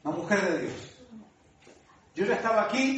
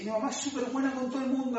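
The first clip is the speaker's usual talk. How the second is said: ordinary talk, nothing marked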